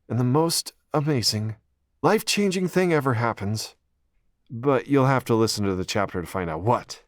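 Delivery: chapter teaser. A narrator's reading. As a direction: tease the listener like a trailer. Chapter title teaser read.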